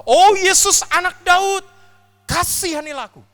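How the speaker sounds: full-force high cry of mercy; desperate shout. Acted. Beggar's third cry to Jesus, loudest desperate shout. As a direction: scream it with everything left. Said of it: "dia terus teriak dan berkata".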